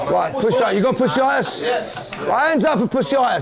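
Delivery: very high-pitched mock roll call; strained, high shout to a group; shouting to squad. Real, not acted. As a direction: holler it round the dressing room. Manager bellowing mock roll call.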